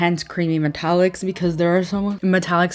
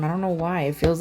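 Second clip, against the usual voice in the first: normal talking voice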